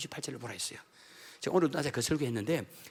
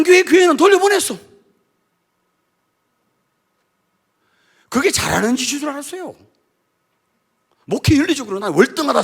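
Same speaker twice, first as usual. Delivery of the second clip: loud, high preaching in full force; 11 semitones higher, 13 dB louder than usual. Acted. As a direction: preach it out with fire.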